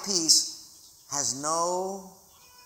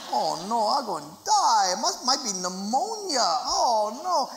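higher, hammed-up panicky whine; mock panic; 4 semitones higher than usual. Preacher mocking his own fear of dying, hammed-up panic. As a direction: play your own silly dread of dying.

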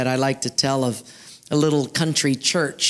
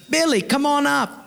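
high, coaxing call; bright-pitched acted call; calling him up; 10 semitones higher than usual. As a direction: summon him from the crowd. Crowd calls Billy up.

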